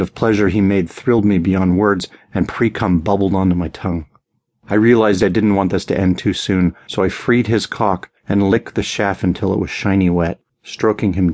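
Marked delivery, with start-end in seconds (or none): none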